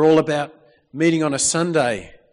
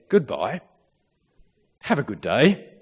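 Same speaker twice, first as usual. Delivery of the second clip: put-on Matt's accent; 3 dB louder than usual. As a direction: attempt at Matt's accent, swooping pitch. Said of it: "trying to do that in Matt's accent".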